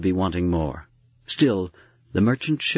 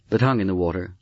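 plain reading voice

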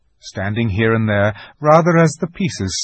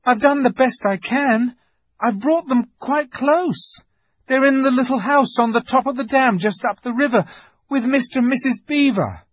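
high, anxious excuse; boy desperate to appease the witch; pleading boy; 5 dB louder than usual. A narrator's reading. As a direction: explain yourself urgently in a high voice.

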